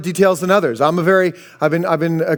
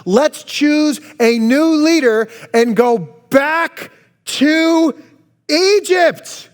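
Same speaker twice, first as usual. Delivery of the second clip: plotting; 7 semitones higher, much slower than usual. Retold or imitated. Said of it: "They plotted among themselves".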